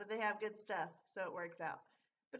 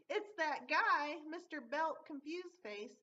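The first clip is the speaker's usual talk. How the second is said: mock yell; 6 semitones higher, 3 dB louder, slower than usual. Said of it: "you hear him yelling".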